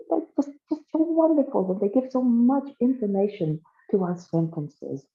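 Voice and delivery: gushing. Acted. Governor enamored of the little prepositions, gushing. Governor gushes over the goblins.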